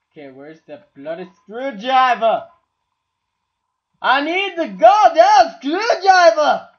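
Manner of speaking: fed up and exploding; angry building to shout. Real, not acted. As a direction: build from grumble to an all-out yell. Frustrated search.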